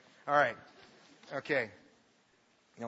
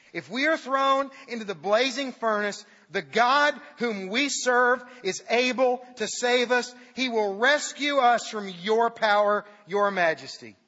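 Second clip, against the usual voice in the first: defiant proclamation; 5 semitones higher, 6 dB louder than usual. Acted. Defiant reply to the king.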